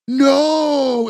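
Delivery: long, high, drawn-out mock cry; retold yell. Imitating a son at the car window, mock yelling. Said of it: "he's, like, yelling at his window".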